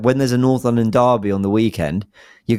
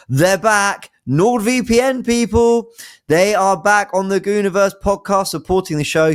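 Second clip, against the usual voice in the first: higher, hyped sponsor intro; excited announcement; 11 semitones higher, slower than usual. Acted. Host hyping NordVPN's return to listeners, pitched up and excited. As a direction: announce the sponsor like great news.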